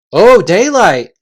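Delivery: eager relief. Acted. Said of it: "said the chief voice".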